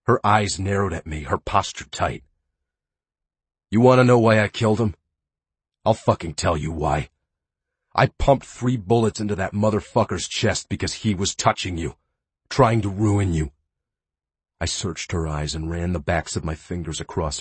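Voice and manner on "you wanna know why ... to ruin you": angry outburst. Acted. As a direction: spit it out fast and furious. Angry confession of killing.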